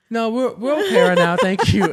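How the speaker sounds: laughing while mimicking the family's polite refusal; laughing quote. Patients politely refusing help, laughing.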